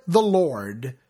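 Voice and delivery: second "the Lord" dropped low and settled; proclaimed echo. Acted.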